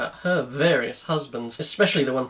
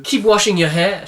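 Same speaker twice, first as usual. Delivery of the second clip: raised, louder instruction; instructing; 5 semitones higher, 7 dB louder than usual. A narrator's reading. Woman surprising her partner in the shower, instructing. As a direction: give a firm, louder order. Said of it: "she instructed".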